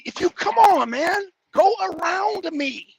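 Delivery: loud, high, drawn-out yell at a driver; road rage. Real, not acted. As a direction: bark at the car to go around. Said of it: "Little road rage".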